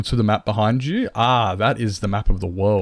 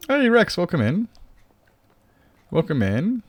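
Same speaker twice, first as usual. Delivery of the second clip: excited greeting; 9 semitones higher, 4 dB louder than usual. Real, not acted. Greeting new viewer excitedly.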